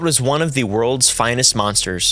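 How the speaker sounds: solemn narration over music; dramatic reading. Acted. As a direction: read it like a spooky essay. Reads a spooky essay aloud.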